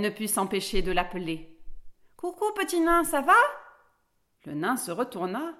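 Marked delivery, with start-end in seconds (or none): 2.2-3.3 put-on high-pitched greeting of a little girl; cheerful call. A narrator's reading. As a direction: call out to a friend, high and lively. Girl calls out to dwarf.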